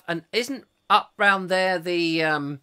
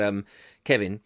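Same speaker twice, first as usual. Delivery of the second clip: normal talking voice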